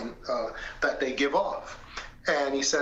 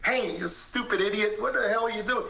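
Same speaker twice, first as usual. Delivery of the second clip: mock yell; 6 semitones higher than usual. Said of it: "to yell at him and to say".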